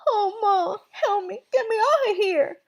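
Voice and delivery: high, garbled wail; muffled, high crying plea; mouth stuck. Acted. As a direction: whine for help with lips stuck. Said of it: "sounding like he had something in his mouth".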